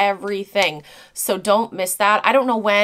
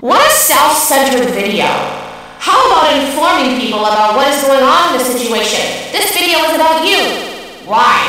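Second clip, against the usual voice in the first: loud, higher, indignant comment read; angry commenter; 4 semitones higher, 7 dB louder than usual. Retold or imitated. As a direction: blast it like an outraged hater.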